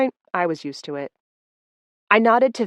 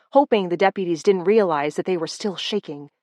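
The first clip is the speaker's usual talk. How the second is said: ordinary delivery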